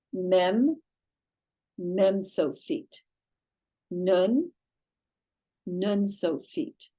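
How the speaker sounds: each letter said slowly and clearly for the class to echo; teacher drilling the sofit letters; repeat after me. Shown as a demonstration. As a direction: lead the students through the final forms. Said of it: "say with me, please ... repeat after me".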